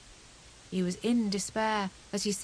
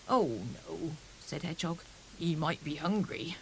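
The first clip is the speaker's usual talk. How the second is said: put-on lower, worried old father; lightly voiced old hedgehog; 4 semitones lower than usual. Old Hedgehog fearing the hungry owl, low and worried.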